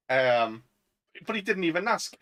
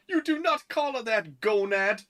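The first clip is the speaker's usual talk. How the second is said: indignant retort; 6 semitones higher than usual. Retold or imitated. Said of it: "And he's like".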